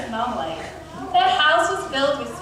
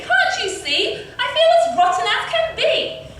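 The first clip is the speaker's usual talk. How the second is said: high, loud complaint; miserable, high-pitched outburst; crying out; 11 semitones higher, 4 dB louder than usual. A narrator's reading. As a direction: wail the grievance in a raised voice. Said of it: "cried Cindy".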